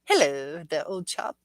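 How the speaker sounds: hammed-up British old-chap greeting; British accent. Shown as a demonstration. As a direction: greet them in mock British. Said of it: "British accent mine is".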